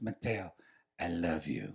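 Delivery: soft, tender I love you; tender confession. Retold or imitated. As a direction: murmur it like a lover. Lover's tender confession retold.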